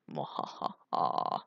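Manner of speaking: slow low villain laugh; evil laugh. Pretend. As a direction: gloat like a cartoon villain. Plans to conquer Aubergine.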